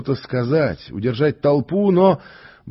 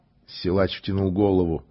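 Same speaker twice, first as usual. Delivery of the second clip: ordinary delivery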